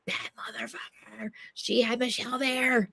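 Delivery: slow, hissed whisper of outrage; retold seething whisper, drawn out; losing it. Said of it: "I was, like, losing it".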